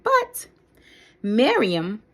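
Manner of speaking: ordinary delivery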